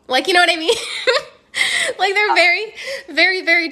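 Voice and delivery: high, loud, amused laughing talk. Real, not acted. Coach laughs at her telenovela imitation, amused.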